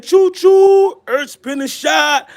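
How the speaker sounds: very high, drawn-out choo-choo holler; hyped train call. Acted. Streamer reads out a viewer's choo-choo comment, hyped train call. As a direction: hoot it like a train whistle.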